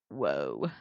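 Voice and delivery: deep, soft, dumbfounded whoa; husband dumbfounded; stunned low whoa. Retold or imitated. Partner voiced stunned.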